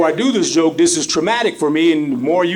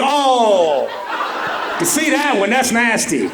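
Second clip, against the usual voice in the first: blind guy heckling; 5 semitones higher, slower than usual. Retold or imitated. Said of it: "Blind guy's like".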